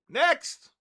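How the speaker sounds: sudden high, loud shout; shouted next. Real, not acted. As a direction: bark it out to the crowd. Calling next winner.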